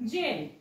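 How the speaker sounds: जेल opening on a j sound, low; soft G. Shown as a demonstration. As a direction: model जेल with ज, never hard g. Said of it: "तुम्हाला कळेल की ज".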